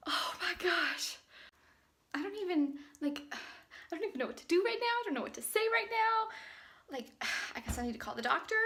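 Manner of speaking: quiet, stunned, breathless disbelief; barely above a whisper, overwhelmed; soft trembling excitement; hushed shock. Real, not acted. Recorded clip right after test.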